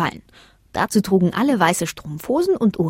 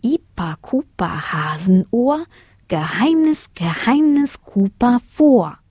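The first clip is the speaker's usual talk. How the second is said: slow, drawn-out incantation; magic spell; 4 semitones higher, slower than usual. A narrator's reading. Girl speaking the magic words, slow incantation. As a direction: chant the magic words slowly, rising high then falling. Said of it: "sprach die magischen Worte".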